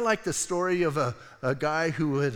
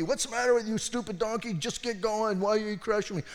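annoyed rider; 6 semitones higher than usual. Retold or imitated. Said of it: "he hits the donkey".

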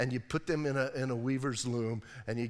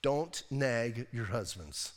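slow warning; 4 semitones lower, slower than usual. Real, not acted. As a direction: lean in and warn the ladies. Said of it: "Ladies, this is for you".

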